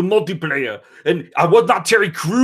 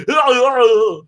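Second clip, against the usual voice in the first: silly singsong; 4 semitones higher, 5 dB louder, slower than usual. Retold or imitated. Button mashing chant.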